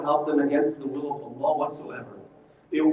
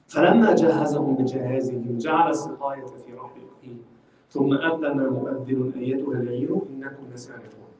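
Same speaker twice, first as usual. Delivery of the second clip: measured Arabic recitation, lower than the talk; Quran recitation; 4 semitones lower, much faster than usual. Acted. Teacher recites the verse to the class, reciting. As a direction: recite the verse in a low, even chant.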